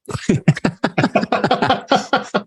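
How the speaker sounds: high bursting laugh; big laugh. Real, not acted. Laughing at own remark.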